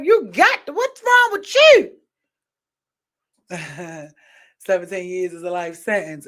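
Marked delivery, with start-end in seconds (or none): 0.0-1.9 higher, louder, slower incredulous outburst; a hammed-up, high, loud cry of disbelief; mock disbelief at an ex. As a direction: ham up the shock at who your ex chose. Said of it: "see people with your exes and be like".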